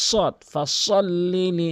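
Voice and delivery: ফসল with a light, slow s; soft s sound. Shown as a demonstration. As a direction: stretch ফসল লি slowly with a soft s. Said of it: "এখানে সতে উচ্চারণ করুন".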